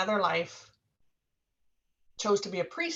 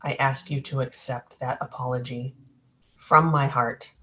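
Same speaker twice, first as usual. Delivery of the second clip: solemn healing apology; 4 semitones lower than usual. Acted. Apologizing to past-life woman.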